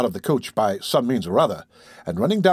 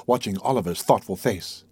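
ordinary delivery, nothing marked

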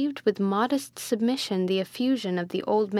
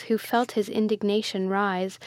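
ordinary delivery, nothing marked

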